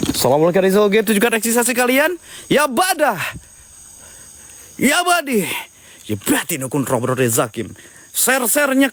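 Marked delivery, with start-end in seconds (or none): 0.1-7.7 summoning incantation. Acted. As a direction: chant the summons to the spirits, half-sung. Ritual incantation to summon spirits.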